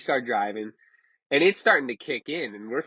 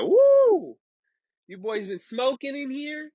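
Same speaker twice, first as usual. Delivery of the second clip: mock sheriff reacting to the smoke, pitched way up; imitating a cop; 13 semitones higher, slower than usual. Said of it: "he makes that face, and he's like".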